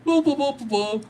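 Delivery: high, silly voice parodying himself; squeaky mock-announcer self-parody; mock self-important voice. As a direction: ham up a pompous streamer blathering. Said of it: "I'm just over here just like".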